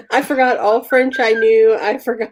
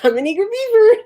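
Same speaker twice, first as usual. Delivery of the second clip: high-pitched mock eagerness; imitated eager blurt; 12 semitones higher, 3 dB louder than usual. Friend repeats the embarrassing line, high mock eagerness. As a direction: pipe it up in a raised voice.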